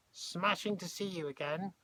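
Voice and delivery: high, lilting greeting; cheerful old lady. A narrator's reading. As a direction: greet them cheerily, pitched well up. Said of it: "Nellie cheerfully".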